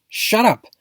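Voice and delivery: high sharp burst of "shut up"; outburst. A narrator's reading. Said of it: "burst out Duck".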